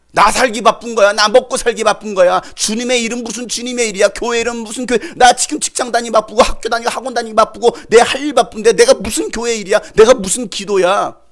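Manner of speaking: mock busy believer. Preacher voices a busy believer's excuses, loud and exasperated. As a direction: brush off the church like a harried worker.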